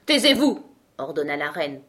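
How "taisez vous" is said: high, loud angry command; furious. A narrator's reading. The queen orders Alice to be silent, furious. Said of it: "pourpre de fureur".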